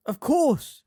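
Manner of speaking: high, loud realisation; sudden cry. A narrator's reading. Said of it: "cried Hermione".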